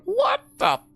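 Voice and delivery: very high squeaky cut-off curse; a high-pitched bleeped-off reaction; mock startled squeal. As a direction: yelp it in sudden confusion. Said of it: "I'm like".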